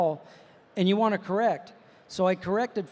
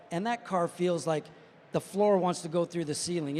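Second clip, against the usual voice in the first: normal talking voice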